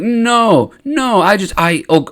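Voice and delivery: mock panic, tripping over your excuse; flustered denial. Qui-Gon flustered, defending himself when accused.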